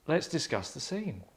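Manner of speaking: ordinary talk, nothing marked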